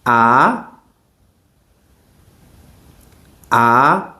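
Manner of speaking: A in the rising second tone. Shown as a demonstration. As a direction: lift the A upward like a question. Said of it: "Second tone".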